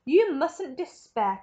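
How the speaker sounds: slow, emphatic cry; cried. Acted. Wonka reassures the family, a slow emphatic cry. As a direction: urge them not to despair, drawn out. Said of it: "cried Mister Wonka".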